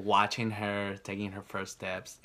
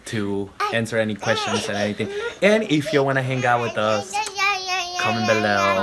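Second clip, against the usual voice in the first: youtube outro; 10 dB louder than usual. Acted. Outro call to viewers.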